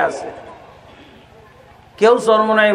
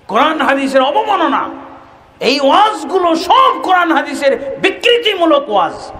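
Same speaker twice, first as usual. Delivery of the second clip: higher, raised preaching cry; fiery preaching; 7 semitones higher than usual. Acted. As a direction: drive the point home to the crowd. Preacher condemning sermons.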